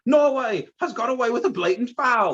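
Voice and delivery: high-pitched British football commentator; British announcer accent. Retold or imitated. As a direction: call the foul like an excited British match announcer. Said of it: "a British guy who was announcing it".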